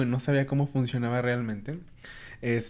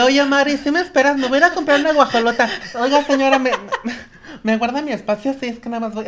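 much higher, louder, hurried mock plea; high pleading voice; 9 semitones higher, 7 dB louder than usual. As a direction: play the sweet Mexican asking a favour, fast. Asking stranger to hold place.

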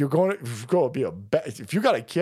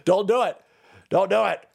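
louder, firm warning; 6 semitones higher, 4 dB louder than usual. Real, not acted. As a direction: stop them firmly. Repeated warning, loud first.

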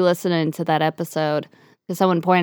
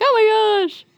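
high surprised shriek; mock shriek of surprise. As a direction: squeal oh my gosh. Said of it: "I'm like".

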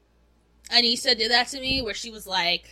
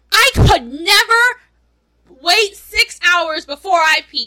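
loud disbelief; 7 semitones higher, 10 dB louder, much slower than usual. Real, not acted. Exclaiming about holding pee.

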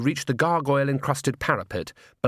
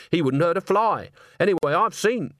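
higher-pitched, heated defence; hotly indignant; 6 semitones higher than usual. A narrator's reading. Agnes standing up for Walter, indignant. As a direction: leap to his defence with mild heat. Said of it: "said Agnes hotly".

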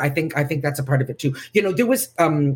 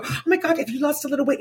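gushing friend; 9 semitones higher, 3 dB quieter, faster than usual. Retold or imitated. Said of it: "your friends are go".

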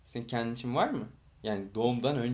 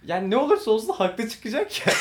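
laughing tease; 8 semitones higher, 7 dB louder than usual. Real, not acted. Teasing friend, laughing.

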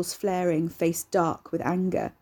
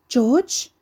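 high, louder call from upstairs; sibilant woman calling; 6 semitones higher, 7 dB louder than usual. Acted. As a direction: call up the stairs, worried. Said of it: "a sibilant woman's voice whiffled down from upstairs".

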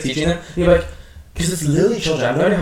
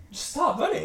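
mock pleading; 13 semitones higher, 11 dB quieter than usual. Yelling at kids in VR game.

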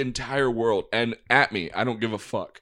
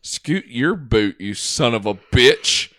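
loud, slow mock outrage; hammed-up mock yell; mock shout; 7 dB louder, slower than usual. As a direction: drag it out, playfully loud. Mock-angry retort, loud and slow.

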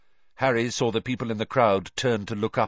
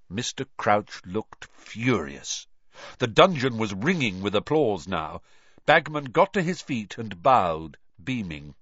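ordinary delivery

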